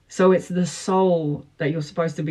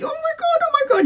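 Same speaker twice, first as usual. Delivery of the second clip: mock giddy squeal, much higher; giddy nervous client; faster than usual. Tarot reader mimicking a smitten client's giddy squeal. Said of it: "you're like".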